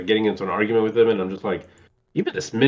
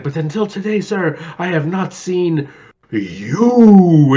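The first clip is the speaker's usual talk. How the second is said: slow, high, booming dramatic pronouncement; hammed-up Gomez Addams gravitas; grand Raul Julia; 7 semitones higher, 6 dB louder, much slower than usual. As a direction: intone it like a tragic stage actor. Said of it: "He's like".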